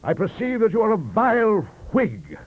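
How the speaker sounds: high, loud Johnsonian outrage; pompous roar. A narrator's reading. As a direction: thunder at the vile Whig. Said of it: "he roared".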